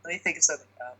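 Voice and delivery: ordinary talk, nothing marked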